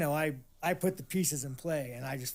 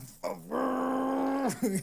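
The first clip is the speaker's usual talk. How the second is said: burst of laughter; 10 semitones higher, 4 dB louder than usual. Real, not acted. Recalls holding back laughter in court.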